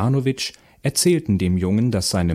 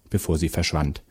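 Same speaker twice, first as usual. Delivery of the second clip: ordinary delivery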